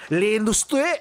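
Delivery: loud, high French cry of "L'Industrie!"; French shout. Retold or imitated. Random Parisian shouting "L'Industrie!" in French accent. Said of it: "You hear someone just say it out loud".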